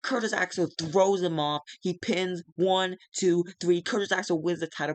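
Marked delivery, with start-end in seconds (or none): none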